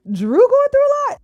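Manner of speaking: high, loud disbelief; incredulous outburst. Acted. Host mocks comment pitying crying Drew, incredulous. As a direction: scoff at the idea in disbelief. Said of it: "Not the kids, but Drew".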